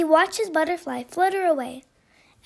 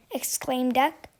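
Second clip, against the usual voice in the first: ordinary delivery, nothing marked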